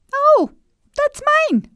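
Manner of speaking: put-on very high little girl voice; high girlish protest; Gina's voice. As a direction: squeak it out like a small girl.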